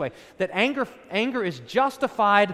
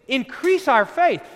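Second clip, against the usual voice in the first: pleading disciples; 6 semitones higher, 7 dB louder than usual. Retold or imitated.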